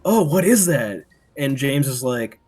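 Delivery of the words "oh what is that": high, loud, slowed alarmed cry; mock alarm at something in the hole; freaked out. Recalling seeing the creature, a startled mock cry.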